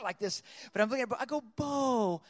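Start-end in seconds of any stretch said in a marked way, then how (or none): 1.6-2.2 high, innocent mock worry; feigned concern. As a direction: act sweetly puzzled, as if blameless. Feigned innocent concern for squeezed bird.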